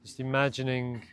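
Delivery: calm, measured meditation voice with long pauses; guided meditation. Real, not acted. Meditation teacher guiding listeners to de-stress after a busy day, calm and spacious. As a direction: ease them gently through each instruction, unhurried. Leads a body scan meditation.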